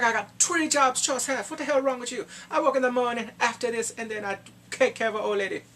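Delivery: put-on high, loud, indignant Jamaican accent. Jamaican coworker boasting of many jobs, indignant Jamaican accent. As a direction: imitate an exasperated Jamaican coworker. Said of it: "I actually had a Jamaican coworker".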